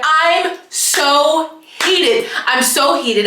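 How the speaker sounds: high, loud, drawn-out frustration; worked up and letting it out; heated venting. Real, not acted. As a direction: stretch it out in an exasperated high outburst. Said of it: "I just gotta get out of my body".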